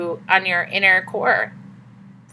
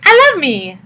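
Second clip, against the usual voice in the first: higher, louder, gushing self-love mock; 6 semitones higher, 5 dB louder, slower than usual. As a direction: chirp it like a cheesy affirmation. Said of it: "woke up the next day and was like".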